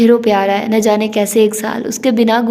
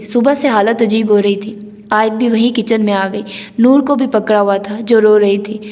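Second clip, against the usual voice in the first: usual speaking voice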